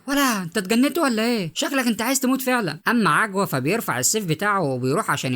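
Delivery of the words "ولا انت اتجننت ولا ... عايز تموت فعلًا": put-on a girl shouting in outrage, pitch up; angry yell. A narrator's reading. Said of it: "فبتزعق مديحه".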